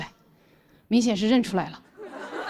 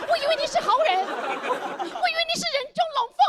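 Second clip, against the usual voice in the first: much higher, wounded foreign friend; foreigner Thomas. Retold or imitated. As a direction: squeak it out like a hurt naive foreigner. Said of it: "狗仗人势冲了过来". (托马斯的委屈外国腔，声音高很多)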